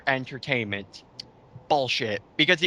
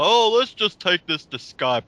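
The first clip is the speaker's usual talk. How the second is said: mocking imitation; 4 semitones higher, 5 dB louder than usual. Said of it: "it's like".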